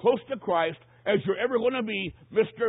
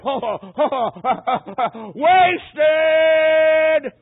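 high, drawn-out wail at full volume; long, loud cry building from broken moans; anguished scream; 10 semitones higher, 13 dB louder, much slower than usual. Retold or imitated. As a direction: scream it out in imitated agony. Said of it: "he just screamed out".

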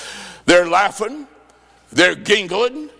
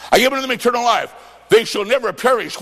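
quick recital; 4 semitones higher, faster than usual. Shown as a demonstration. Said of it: "What does that mean?".

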